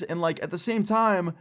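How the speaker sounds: ordinary delivery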